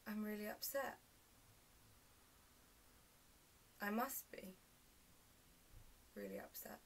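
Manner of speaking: soft, faltering, with long pauses; hushed realisation. Acted. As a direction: fade to a whisper as it dawns on you. Monologue's quiet realisation of being upset.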